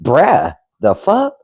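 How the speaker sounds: incredulous mock teen backtalk, pitch jumping up on the question; imitating the kids' talk. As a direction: mimic a kid's rude disbelief. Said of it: "that's how they talk to me".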